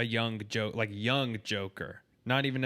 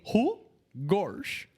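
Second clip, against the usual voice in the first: Goofy impression; 7 semitones higher than usual. Shown as a demonstration. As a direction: demo the Goofy line. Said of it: "me saying".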